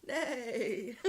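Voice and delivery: loud comic horse whinny; horse neigh. Pretend. Joking about her asthma-hoarse voice, a loud mock horse neigh. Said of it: "voice goes very hoarse".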